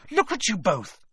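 high-pitched scolding cry; a contained, high shriek at them both. A narrator's reading. Hermione scolds the two trapped boys, shrieking. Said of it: "shrieked Hermione".

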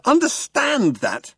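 very high, slow outraged repeat. A narrator's reading. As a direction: drag it out in disbelief. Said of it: "shouted Arthur".

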